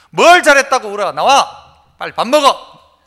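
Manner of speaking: loud mock scolding, the last order slowed; scolding mother. Mother orders the crying child out to eat, loud scolding. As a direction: bark at the sulking kid, then order them to eat. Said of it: "어머니가 부릅니다".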